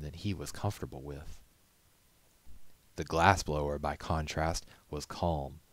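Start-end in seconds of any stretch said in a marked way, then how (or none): none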